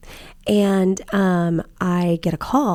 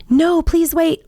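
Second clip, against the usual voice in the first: mock plea; 9 semitones higher, 4 dB louder, much slower than usual. Creep begs before being blocked.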